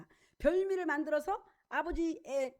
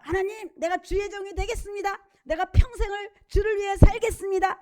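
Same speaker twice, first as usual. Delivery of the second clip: higher, louder earnest vow; a young man swearing lifelong service, pitched higher and louder; Jacob's vow; 5 semitones higher, 6 dB louder than usual. Acted. Jacob vowing to God, higher and louder, earnest.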